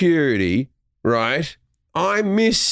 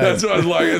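ordinary talk

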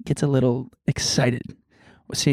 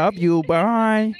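put-on baby talk; 5 semitones higher, much slower than usual. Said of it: "Why is no one doing it back?".

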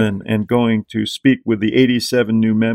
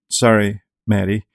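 ordinary talk, nothing marked

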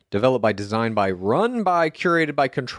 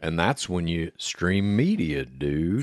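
lower, slow drowsy wrestler drawl; slowed-down Hogan; 7 semitones lower, 4 dB quieter, much slower than usual. Acted. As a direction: drag it out like a sedated Hulk Hogan. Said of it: "hydrocodone Hogan at the end, brother, when he slows down".

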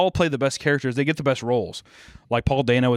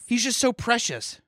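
high, slow, gushing fangirl voice; mock fangirl cooing; mocking cutesy fangirl; 11 semitones higher, slower than usual. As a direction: coo it like a smitten fan girl. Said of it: "but people are like".

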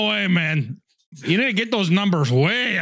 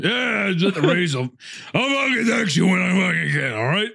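annoyed texting voice; 3 semitones higher than usual. Retold or imitated. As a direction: shout it like an annoyed buddy. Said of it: "what I sound like in text form".

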